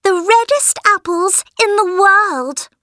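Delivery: put-on high whiny demanding girl; spoiled girl voice. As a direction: whine it high like a brat. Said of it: "said Helen".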